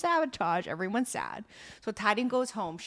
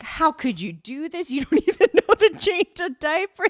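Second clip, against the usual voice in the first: mock scolding, pitched up and louder; berating; 7 semitones higher, 5 dB louder than usual. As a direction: scold her like an outraged boss. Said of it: "he is berating".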